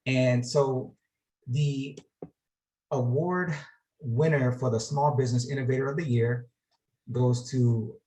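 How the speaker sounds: ordinary talk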